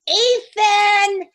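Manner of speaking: loud high call across the room. Acted. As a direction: call him over loudly. Calling her son loudly.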